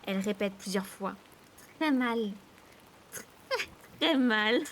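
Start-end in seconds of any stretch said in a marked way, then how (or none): 1.8-4.6 defiant sneering. A narrator's reading. Sister repeating her threat to brother, defiant sneer. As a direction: menace broken by snickers. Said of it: "une sorte de ricanement de défi".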